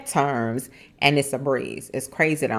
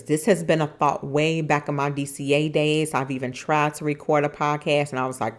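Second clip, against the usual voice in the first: ordinary talk, nothing marked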